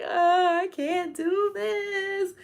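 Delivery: high, very slow despairing whine; overwhelmed whine. Retold or imitated. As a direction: stretch it out, defeated. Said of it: "I'm like".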